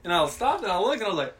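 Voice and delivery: fast, rising alarm; shocked. Retold or imitated. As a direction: blurt it out startled. Sudden shock at confrontation.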